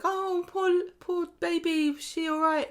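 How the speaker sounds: high, cooing imitation of a fussing dad; a lightly mocking high baby-talk voice; doting dad. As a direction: coo over the baby like a worried father. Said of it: "he's like".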